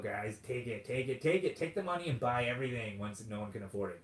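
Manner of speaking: normal talking voice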